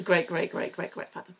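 trailing off into a near whisper; fading to a whisper. Real, not acted. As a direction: drop it away to a whisper by the end. Repeating the ancestor's title.

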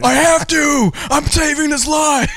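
high, shouted mock hero; shrill-high fake heroic yelling; mock pedophile shout. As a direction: yell it as a deranged lifesaver. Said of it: "They're like".

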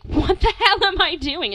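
high, loud panicked self-question; a hammed-up loud moment of panic; retold panic. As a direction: cry out in mock alarm. Retold panic near owl.

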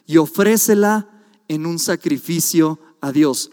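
lectura enfática, lenta y fuerte; scripture reading. Acted. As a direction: proclama el versículo despacio. Lee la escritura con énfasis. (lectura bíblica solemne y fuerte)

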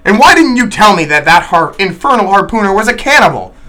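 an outraged guest accusing the landlord; angry accusation. Acted. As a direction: hurl the question at him.

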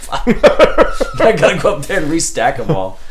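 chuckling through the words; laughing while he talks. Real, not acted. Laughing while imagining the mess.

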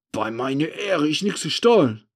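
loud, rising agitated oath. A narrator's reading. The accused man swearing he stole nothing, agitated. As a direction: swear innocence in a fluster. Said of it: "erklärt er aufgeregt".

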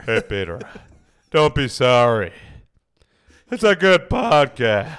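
very loud, emphatic cartoon impression; Joe Swanson. Shown as a demonstration. Host tells Peter not to be sorry, Joe Swanson impression. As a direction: belt it out as the cartoon neighbour. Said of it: "the Joe Swanson voice".

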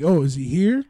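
loud, pointed mock question. Voicing what cops never asked, loud mock question. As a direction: call it out as if checking at a door.

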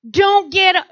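usual speaking voice

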